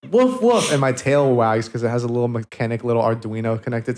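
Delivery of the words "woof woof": high-pitched woofing; dog bark. Acted. Fursona showing how it picks up a bone, high barking. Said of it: "I have to go".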